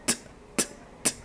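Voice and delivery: very soft tongue clicks "t", no voice; voiceless T sound. Shown as a demonstration. Teacher demonstrating the sound of T, unvoiced ticks. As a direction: release bare T's with breath only. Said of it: "Esse é o som do T".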